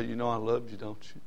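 put-on soft, low gentle question to a child; tender reassurance. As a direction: comfort a crying daughter softly. Father comforting his daughters after discipline.

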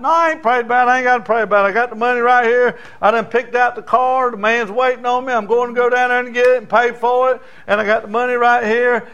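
loud, high bragging; cocky boy. Acted. Boy loudly bragging to the preacher that he needs no prayer. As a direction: brush the preacher off, sure of himself.

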